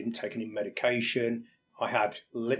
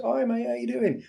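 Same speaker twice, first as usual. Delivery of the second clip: high, chirpy mate on the phone; much higher bright caller voice; breezy phone greeting; 12 semitones higher than usual. Retold or imitated. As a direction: toss off a cheery hello to a mate. Acted casual phone call opener.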